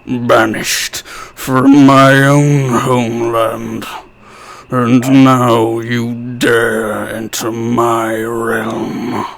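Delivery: slow, low, menacing villain voice; drawn-out dark threat, deep and grand; Liam O'Brien villain voice. Pretend. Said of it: "my Liam O'Brien".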